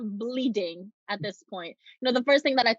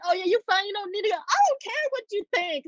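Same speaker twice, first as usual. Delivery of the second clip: mock dismissive group; 9 semitones higher than usual. Mocking the group.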